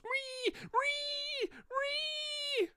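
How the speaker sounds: very high, piercing rabbit squeals; screaming rabbit. Retold or imitated. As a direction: squeal like a rabbit caught in jaws. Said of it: "the rabbit goes".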